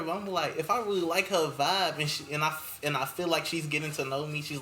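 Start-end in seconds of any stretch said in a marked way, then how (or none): none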